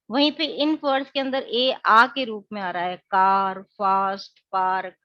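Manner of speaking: कैट, एप्पल, बैग with a flat short ए, कार, फास्ट, पार्क with a long open आ; teacher sounding out each word loudly; vowel sound demo. Shown as a demonstration. Teacher to students, demonstrating ए sounds loudly. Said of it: "अगर हम alphabet ए की बात करें".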